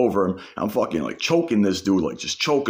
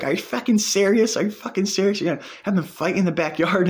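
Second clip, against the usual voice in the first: much higher, incredulous put-on woman's voice; imitating outraged girlfriend; 11 semitones higher than usual. Girlfriend outraged at a backyard fight, much higher incredulous voice. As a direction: voice her disbelief, pitched way up.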